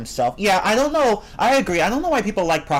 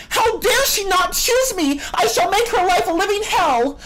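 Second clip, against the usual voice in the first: put-on ridiculous high-pitched mean girl outrage; mock mean girl; 3 dB louder than usual. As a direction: play the petty villain, huge and squeaky. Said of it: "the mean girl's like".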